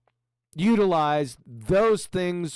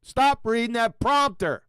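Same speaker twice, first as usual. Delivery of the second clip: raised, higher, drawn-out rebuke; exasperated raised-voice order; scolding yell; 6 semitones higher, 4 dB louder than usual. Real, not acted. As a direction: scold the speaker loudly and slowly. Scolds the clip's speaker.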